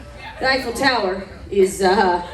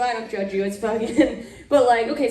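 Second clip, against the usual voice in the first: normal talking voice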